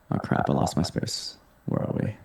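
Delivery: soft unvoiced mutter under the breath; muttering to herself. Real, not acted. Host loses her place in the questions, whispered aside. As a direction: whisper it to yourself while scrolling.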